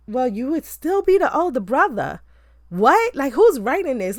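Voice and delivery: high, loud incredulous outburst; exasperated disbelief. Acted. Film reviewer objecting to a silly plot point, exasperated disbelief.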